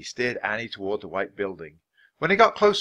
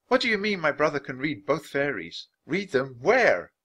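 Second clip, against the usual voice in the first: lightly voiced girl, pitch raised; higher, puzzled girl's voice; questioning young girl; 6 semitones higher than usual. A narrator's reading. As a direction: question him, slowing on the last words.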